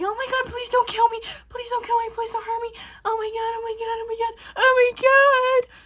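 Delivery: very high-pitched imitation of terrified begging; mock panicked pleading. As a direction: freak out, hammed-up, begging for your life. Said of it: "She's freaking out".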